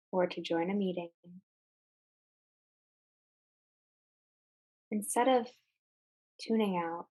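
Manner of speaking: calm meditation guide with long, unhurried pauses; guided meditation. Real, not acted. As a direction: ease listeners into mindful breathing, phrase by phrase. Leads a guided mindfulness exercise.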